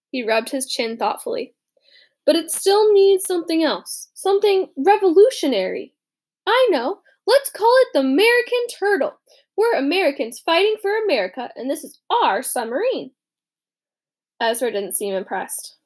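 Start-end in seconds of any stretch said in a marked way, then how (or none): 2.2-13.1 put-on an excited young patriot, higher and louder; proudly christen the submarine; David, proud. David names the sub the American Turtle, proud and raised.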